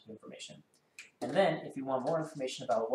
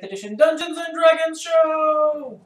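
much higher, loud hyped intro; excited shout; 15 dB louder, slower than usual. Real, not acted. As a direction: shout it like a showman.

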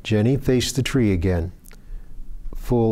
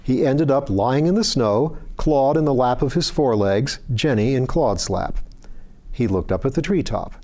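plain reading voice

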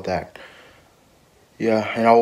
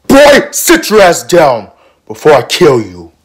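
loud, high, slow-paced order; shouted warning, every word stretched; angry threat; 5 semitones higher, 9 dB louder than usual. Acted. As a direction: bellow a drawn-out threat. Acted threat to Josh.

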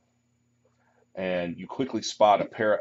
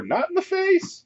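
vain elf yelling; 4 dB louder than usual. Acted. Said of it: "they'll yell".

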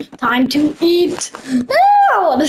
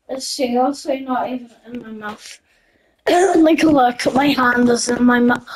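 ordinary talk, nothing marked

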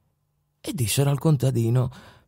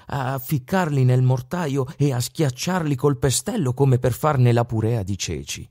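ordinary delivery

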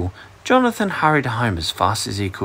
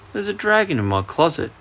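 ordinary delivery

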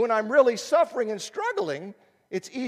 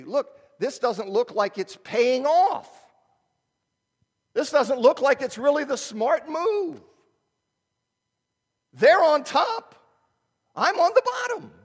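mock whiny complainer; 5 semitones higher, 5 dB louder than usual. Mocking discouraged believers' complaint.

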